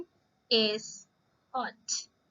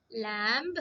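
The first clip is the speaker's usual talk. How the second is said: normal talking voice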